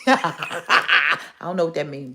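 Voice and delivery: laughing through the words. Real, not acted. Amused woman laughing at a TikTok prompt, laughing. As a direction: laugh at your own confusion.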